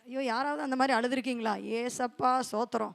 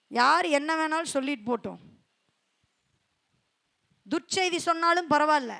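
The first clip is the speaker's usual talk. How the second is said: ordinary talk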